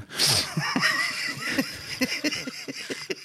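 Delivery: high shrieking burst of laughter. Real, not acted. Punchline about the beschuitje.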